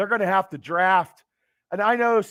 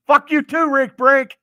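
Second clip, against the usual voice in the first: mock yell; 6 semitones higher, 8 dB louder than usual. Real, not acted. Laughing comeback to a viewer.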